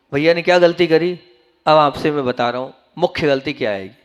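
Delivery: ordinary talk, nothing marked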